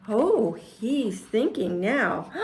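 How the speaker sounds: ordinary delivery, nothing marked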